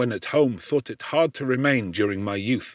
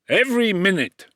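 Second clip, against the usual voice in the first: higher and a bit louder, fervent rebuke; heated; 9 semitones higher, 4 dB louder than usual. A narrator's reading. Professor scolding a careless student, heated. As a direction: burst out with indignant heat. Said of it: "continued Monsieur Krempe with warmth".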